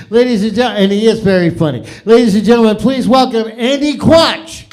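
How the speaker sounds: loud, high host intro voice; emcee announce. Acted. Host introducing Andy Quach to the crowd, announcer style. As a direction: announce the next act to the room.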